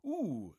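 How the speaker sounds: short high yelp of pain; a pricked finger, cry out; wife's yelp. A narrator's reading. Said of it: "screams the wife".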